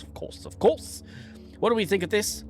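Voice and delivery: ordinary delivery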